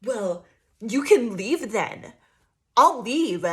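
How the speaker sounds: loud, slow "you can leave then"; loud, slow mock ultimatum; mock huffy threat. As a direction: storm off in a huff. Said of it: "they're coming in like".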